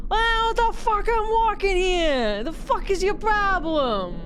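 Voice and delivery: loud, high, drawn-out Chicago yelling; indignant Space Marine with a broad Chicago accent; thick Chicago accent. Acted. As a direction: stretch the words in an outraged Chicago accent. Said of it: "Space Marine with a thick Chicago accent".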